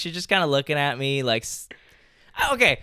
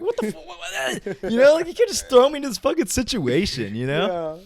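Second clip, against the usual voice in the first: mock panic; 10 semitones higher than usual. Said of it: "I'm, like, so nervous".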